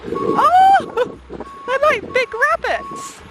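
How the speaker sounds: high delighted coo; excited coo. Real, not acted. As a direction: gush over something adorable. First sight of cute animals.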